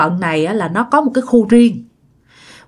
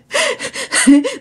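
real high-pitched giggling fit; helpless giggle. Real, not acted. Storyteller cracking up after a slip, high giggle. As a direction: crack up at your own mistake.

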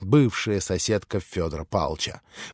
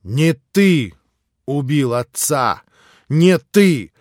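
louder, higher, slow and firm; firm insistence; 5 semitones higher, 9 dB louder, much slower than usual. A narrator's reading. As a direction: drive each word home. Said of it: "твёрдо повторил Алёша".

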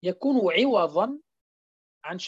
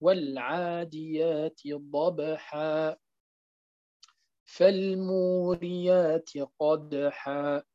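ضبحا ending on a long "a", recited slowly; slow Quran recitation; 5 semitones lower, much slower than usual. Shown as a demonstration. Teacher recites the verses correctly, slow and measured. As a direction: chant each verse slowly, stopping on long a. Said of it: "نقول".

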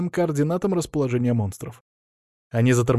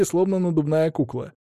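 ordinary delivery, nothing marked

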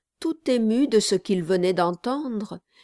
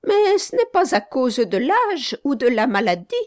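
higher-voiced old village gravedigger, talkative; a higher, louder old man rambling on; 7 semitones higher, 5 dB louder than usual. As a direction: play the chatty old gravedigger, apologetic.

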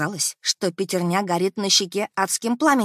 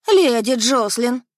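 calling out; 5 semitones higher, 7 dB louder, slower than usual. Acted. Housekeeper calling out on arrival.

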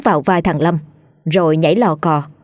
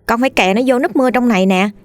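put-on raised, boyish child voice; a young boy earnestly explaining himself; 6 semitones higher than usual. Boy explains his cut-foot delay to an upset father, in a higher child voice. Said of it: "thằng Lâm đứng ra giải thích".

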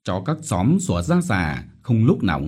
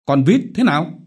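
loud, higher, pointed demand; single her out harshly; glaring demand; 9 semitones higher, 5 dB louder than usual. Acted. Chief singling out Vít, harsh loud demand. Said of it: "Hắn trừng mắt và trỏ tay".

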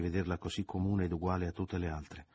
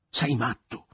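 louder, higher forceful outburst; esclamazione con forza; 6 semitones higher, 8 dB louder, slower than usual. A narrator's reading. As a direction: burst it out with force. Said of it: "proferì alfine con forza". (con forza, a voce alta)

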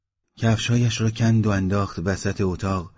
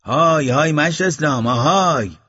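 loud, high, insistent hail; raised voice ringing out across a distance; calling out; 8 semitones higher, 6 dB louder than usual. A narrator's reading. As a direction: call out hard to get someone's attention. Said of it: "دوباره صدایش کرد".

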